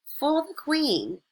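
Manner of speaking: high, slow voice of a woman in the crowd. A narrator's reading. Woman in the crowd offers flowers for the Queen, high and slow. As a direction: hand over the flowers slowly in a high voice.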